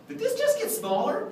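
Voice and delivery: very high baffled question; mock astonishment. Clown pulling out the wand for kids, mock astonished. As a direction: pitch it way up, feigning bewilderment. Repeats the feigned surprise.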